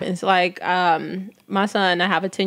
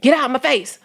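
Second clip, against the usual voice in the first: mom scolding; 5 semitones higher, 3 dB louder than usual. Retold or imitated. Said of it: "You'd be like".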